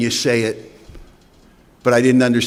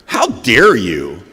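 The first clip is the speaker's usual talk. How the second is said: loud, slow mock outrage; defiant indignation; 4 dB louder, much slower than usual. Drunk snapping back at his brother-in-law, hammed-up outrage. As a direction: play wounded pride to the hilt. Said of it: "I got right in his face".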